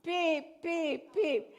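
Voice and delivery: loud, high imitated beeps; mock car beeping. Grandson keeps unbuckling, speaker imitates the alarm beeping. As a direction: beep like the car alarm that won't stop. Retelling seatbelt alarm again.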